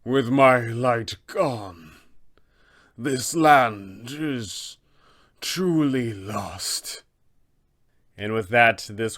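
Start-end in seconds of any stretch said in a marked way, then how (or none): 0.0-7.0 dying abbot. Acted. Defeated abbot speaks his final words, slow and fading. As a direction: let the last words drain away as he dies. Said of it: "he will go ahead and say".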